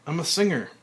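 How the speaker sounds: high, loud proud announcement; exuberant. Acted. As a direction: sing out your new self. Frank exuberant.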